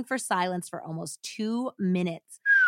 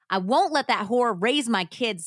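loud, higher cry; 3 semitones higher, 6 dB louder than usual. Acted. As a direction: cry it out in fury. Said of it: "Susan cried".

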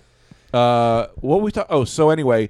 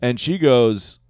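normal talking voice

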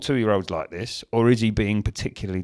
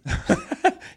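squeaky high laugh; high squealing laugh. Real, not acted. Laugh at the quote.

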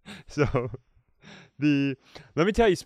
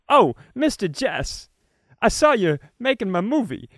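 higher, louder amateur Travolta impression; bad John Travolta; 9 semitones higher, 5 dB louder than usual. Mocking an amateur impressionist, bad Travolta voice. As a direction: ham up a clumsy Travolta. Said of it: "my Travolta is essentially normal guy Keith's".

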